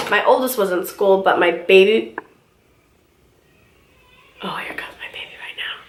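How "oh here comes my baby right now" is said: soft whispered aside to viewers. Real, not acted. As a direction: drop to a breathy whisper mid-chat. Baby walks in, hushed aside.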